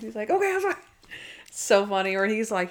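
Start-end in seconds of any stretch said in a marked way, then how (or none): 0.3-0.7 very high mock apology; high-pitched sheepish backing-off; flustered apology. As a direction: imitate someone apologising in a panic, pitched way up. Retelling the character's hasty apology.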